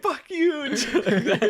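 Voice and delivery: high, loud laughing mock yell. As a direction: yell it through laughter, imitating a drunk friend. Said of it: "he was, like, yelling at Craig".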